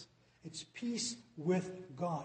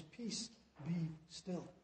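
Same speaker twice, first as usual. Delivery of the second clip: softer and slow, calm and settled; soft calm command; 8 dB quieter, much slower than usual. Retold or imitated. Preacher voicing God calming fearful hearts, soft and slow. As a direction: hush the heart gently. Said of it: "He speaks to our hearts".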